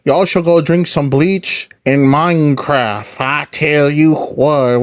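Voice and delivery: slow, drawn-out Southern drawl; Southern accent. Retold or imitated. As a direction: imitate a Southerner, stretching the words. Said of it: "with the Southern accent".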